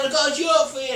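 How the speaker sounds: high, loud shout to a passer-by; calling out. Retold or imitated. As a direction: holler to someone flying past. Calling to man flying past.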